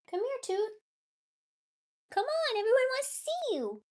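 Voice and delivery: sweet high call to a pet; high cooing pet talk. Real, not acted. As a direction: coo at a shy kitty to come out. Coaxing the cat to come.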